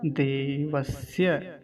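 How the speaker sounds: slow देवस्य with a clear dental s in स्य. Shown as a demonstration. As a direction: draw out देवस्य, keeping स a clean s. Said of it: "इसका उच्चारण क्या हो गया". (देवस्य, दंतीय स के साथ धीमे)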